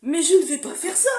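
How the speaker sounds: laughing while refusing; giggly refusal; laughing protest. Real, not acted.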